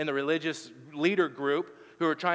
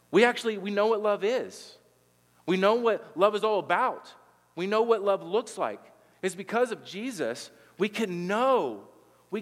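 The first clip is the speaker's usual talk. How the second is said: fervent preaching; 5 semitones higher than usual. Acted. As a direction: proclaim it to the whole room. Preaching climax, rising conviction.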